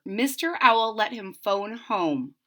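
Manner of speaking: plain reading voice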